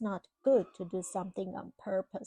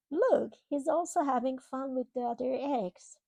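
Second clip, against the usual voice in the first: usual speaking voice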